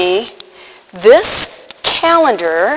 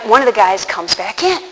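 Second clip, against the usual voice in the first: ordinary talk, nothing marked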